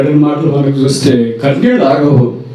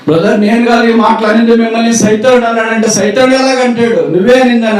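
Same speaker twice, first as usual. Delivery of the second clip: high accusing voice; 9 semitones higher than usual. Acted. Satan's accusation acted.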